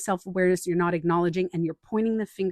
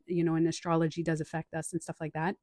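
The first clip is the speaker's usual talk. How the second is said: ordinary delivery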